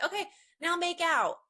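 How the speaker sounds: high, loud teasing call; spring the joke on them, loud and bright; cheeky punchline. Retold or imitated. Photographer teasing posing siblings, loud and high. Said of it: "then I'll say".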